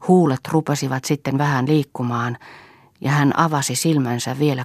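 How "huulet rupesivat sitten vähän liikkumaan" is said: ordinary delivery, nothing marked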